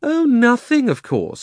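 sarcastic. A narrator's reading. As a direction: drip sarcasm on it. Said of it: "said Polly sarcastically".

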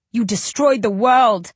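slow anguished cry. A narrator's reading. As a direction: hurl it out in despair. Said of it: "I cry".

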